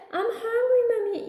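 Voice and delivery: high-pitched mock kid whine; whining child. Son going to bed without dinner, whining child. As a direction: call to mom in a high, needy child voice.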